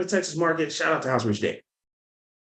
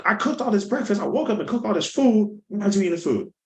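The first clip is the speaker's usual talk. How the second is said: high-pitched, annoyed retelling; mock annoyance; 7 semitones higher than usual. Friend's mom retold scolding a guest who won't eat, mildly annoyed. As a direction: imitate an exasperated cook, pitch raised. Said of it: "She was really annoyed".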